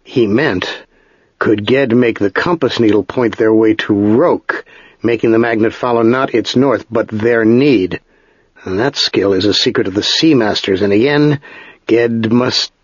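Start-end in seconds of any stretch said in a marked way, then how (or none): none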